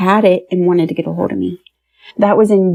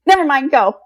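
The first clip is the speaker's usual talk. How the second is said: laughing; 8 semitones higher, faster than usual. Real, not acted. Backing out as they drive past.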